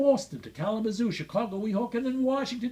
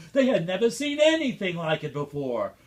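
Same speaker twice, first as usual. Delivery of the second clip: ordinary delivery, nothing marked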